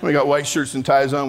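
ordinary talk, nothing marked

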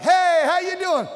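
high, loud chipper hello; cheerful greeting. Retold or imitated. Mimicking a cheerful greeter, high and bright. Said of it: "It's sort of like".

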